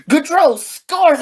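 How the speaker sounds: high, drawn-out goal cry; stretched, high-pitched shout of triumph; excited goal call. Retold or imitated. Retelling the shock winning goal.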